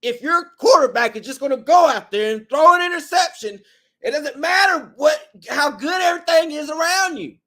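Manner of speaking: loud and high ranting shout; frustrated outburst. Real, not acted. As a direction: vent your fury at the fans. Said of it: "I'm sorry for that outburst".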